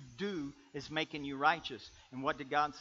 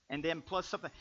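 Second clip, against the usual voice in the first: usual speaking voice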